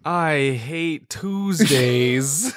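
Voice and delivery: very slow, drawn-out Garfield; slow Garfield drawl. Acted. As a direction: stretch it lazily like Garfield. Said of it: "You be Garfield".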